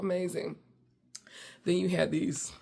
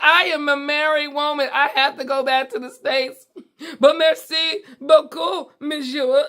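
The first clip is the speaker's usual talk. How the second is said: much higher, louder, giggling through it; laughing refusal; 9 semitones higher, 8 dB louder than usual. Retold or imitated. To the pleading waiter, laughing refusal. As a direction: turn him down through laughter. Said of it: "I'm like".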